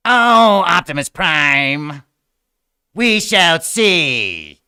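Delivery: loud, slow, high menacing robot; robot villain. Pretend. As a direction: drag out a villain's threat. Megatron voice continues.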